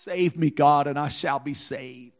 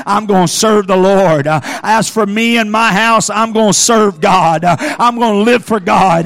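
loud, rhythmic sermon building higher; full-force preaching; 6 semitones higher, 8 dB louder than usual. Real, not acted. Preacher calls young people and parents to take a stand, rousing shouted preaching. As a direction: drive each declaration home in preacher's bursts.

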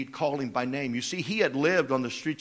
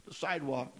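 normal talking voice

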